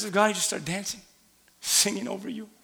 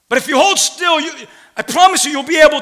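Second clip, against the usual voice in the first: louder and higher, building fervour; preached louder; 9 semitones higher, 11 dB louder, faster than usual. Real, not acted. Preacher repeats his promise to the church, louder and higher. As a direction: lift the promise with force. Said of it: "repeated line, preached".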